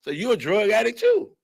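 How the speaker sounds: shooting up high on damn in shock; mock shock at the medicine cabinet, high pitched; mock surprise. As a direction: act floored by what he finds. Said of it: "I'll be like".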